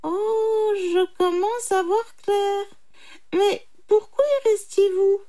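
high put-on voice, dawning understanding; dim little jackal. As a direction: wonder naively, pitched high. Jackal feigning stupidity. (petit chacal naïf, voix aiguë)

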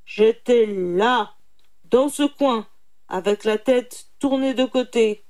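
loud, emphatic phrase by phrase; irritated tiger. A narrator's reading. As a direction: spell it out crossly. Tiger irritably demonstrates his position. (tigre agacé, voix forte)

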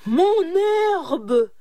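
high, loud furious yell. A narrator's reading. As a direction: howl over the stolen grass. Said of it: "hurla Malengouin".

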